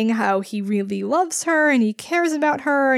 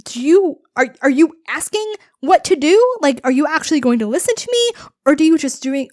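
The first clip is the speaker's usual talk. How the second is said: raised, louder, pointed questioning; acted impatience, higher and forceful; exasperated challenge; 4 semitones higher, 4 dB louder, faster than usual. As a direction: confront him like a fed-up mother. Voicing mother's challenge to mopey son.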